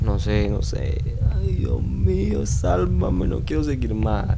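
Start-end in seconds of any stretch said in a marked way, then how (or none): 1.4-4.4 slow, high, fearful pleading; scared, high-pitched plea for help; frightened plea. Real, not acted. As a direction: beg to be saved, drawn out and high. Pleading in a horror game.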